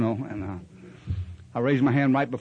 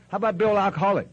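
raised, pushy mock questioning; mocking sponsor voice; 6 semitones higher, faster than usual. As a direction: needle him like a sponsor testing him. Mock sponsor quizzing him.

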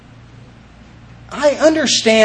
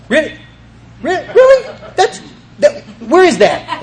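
rising, incredulous "Really?" with a laugh; amazed disbelief; 9 semitones higher than usual. Retold or imitated. Newcomer amazed while reading the big book. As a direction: act out being stunned by each page.

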